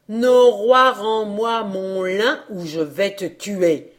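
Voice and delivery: loud, drawn-out call; threatening demand. A narrator's reading. Old man threatening the wind over his stolen flax, loud and slow. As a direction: call out slowly with menace.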